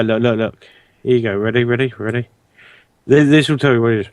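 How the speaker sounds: ordinary talk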